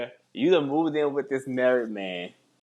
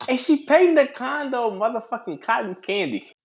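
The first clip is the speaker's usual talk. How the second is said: higher, incredulous rant; indignant exclamation; 8 semitones higher than usual. Real, not acted. Co-host joining the rant about the pink condo, incredulous. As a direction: pile on the outrage.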